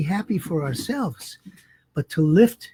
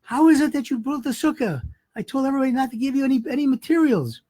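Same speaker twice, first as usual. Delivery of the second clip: higher, indignant accusing question; angry demand; 7 semitones higher, faster than usual. Retold or imitated. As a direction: confront him, outraged. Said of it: "the man is so angry".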